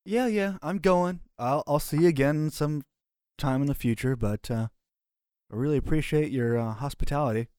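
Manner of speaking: high, mock-grateful brush-off; sarcastic farewell. Player leaving the camp after being shoved, sarcastic thanks. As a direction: thank him with heavy sarcasm.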